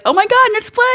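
high squeal of surprise; excited recognition. Retold or imitated. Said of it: "turned around and was like".

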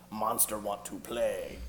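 put-on slow, lightly cooing baby talk to his wife. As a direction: coo it slowly like talking to a baby. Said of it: "baby talking her".

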